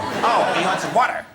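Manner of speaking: high, loud mock American; American accent. American finally understanding the order, high loud American accent. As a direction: exclaim it in a broad American accent, suddenly understanding. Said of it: "And they go".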